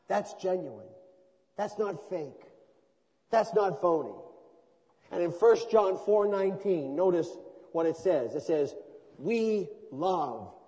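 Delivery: rising into loud, forceful preaching. Acted. Pastor stirring his congregation, forceful preaching. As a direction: drive the point home to the congregation.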